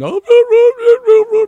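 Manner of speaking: high, loud mock dog howl; imitation of a singing dog. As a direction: howl tunefully like a dog at a piano. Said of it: "when you hear dogs howling".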